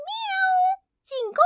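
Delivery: very high meowing attack call; cat's battle cry. Acted. Kitten charging at the ducks, very high meow. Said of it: "猫咪突然大叫起来".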